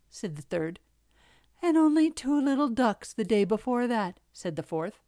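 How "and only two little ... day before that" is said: higher, louder cub voice; complaining little fox. A narrator's reading. Fourth little fox to father, complaining. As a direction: top the others' complaints.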